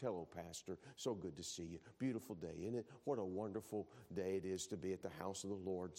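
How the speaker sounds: fake-nice greeting at the church door; a syrupy Sunday smile in the voice; mock sweet churchgoer.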